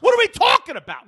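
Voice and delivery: incredulous yelling at the clerk; exasperated outburst. Retold or imitated. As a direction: blow up at the absurd rule. Retold outburst at the desk.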